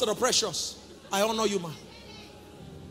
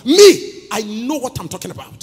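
louder, rising sermon cadence; full-force preaching; 3 semitones higher, 11 dB louder, slower than usual. Acted. Preacher to congregation about his foundation, loud. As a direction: declare it with certainty.

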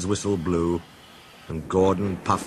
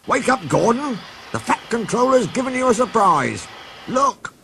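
much higher, eager calling out; bursting with good news; excited; 13 semitones higher than usual. Acted. Driver waking Gordon with a surprise, excited and high. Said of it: "ran back excited".

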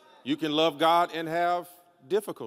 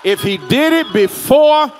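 full-force preaching; 6 semitones higher, 13 dB louder than usual. Real, not acted. Preacher urging the congregation to hold on, full-force preaching. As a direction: build each phrase into a ringing shout. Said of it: "don't y'all make me preach".

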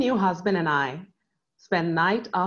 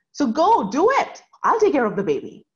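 high, loud gushing mother-in-law; imitating a thrilled mother-in-law; excited mother-in-law; 7 semitones higher, 6 dB louder than usual. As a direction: burst out with her delight. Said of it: "was super excited".

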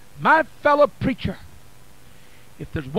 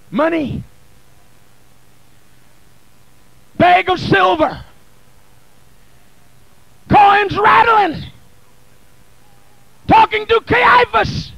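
building from steady to a loud, higher cry on blood; preaching at full force; 6 semitones higher, 5 dB louder than usual. Acted. Preacher urging the congregation, rising to full-force preaching. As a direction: whip the church up, climbing each line. Preacher voices the betrayal scene.

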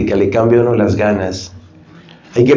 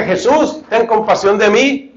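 calling out louder; 9 semitones higher than usual. Retold or imitated. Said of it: "él gritaba más fuerte".

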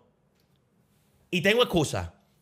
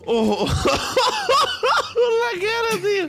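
crying with laughter. Real, not acted. Streamer crying with laughter over a face in the game. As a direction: barely get the words out for laughing. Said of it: "estoy llorando".

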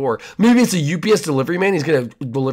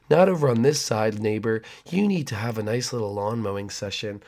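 creepy neighbor; 4 semitones lower, 5 dB quieter than usual. Acted.

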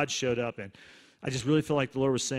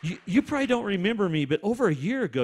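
higher-pitched retold woman's voice; lightly voiced woman; 6 semitones higher, 3 dB louder, faster than usual. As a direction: lift the pitch lightly to quote her. Voicing the woman who approaches him.

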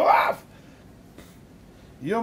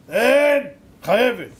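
loud, sharp landlady demand; high, loud acted command; angry insistence; 4 semitones higher, 9 dB louder, slower than usual. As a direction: order her with full force. Landlady insists on Sabbath work.

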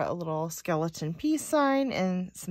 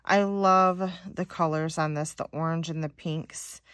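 normal talking voice

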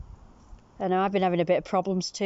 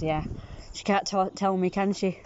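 ordinary talk

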